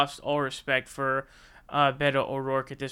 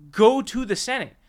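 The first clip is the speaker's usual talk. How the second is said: louder, higher urging; exasperated push; 5 semitones higher, 6 dB louder than usual. Real, not acted. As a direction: shove him toward the Senate. Exasperated rant at politician.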